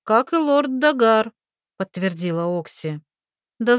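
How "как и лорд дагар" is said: put-on young woman voice. Oksi confirming, loud.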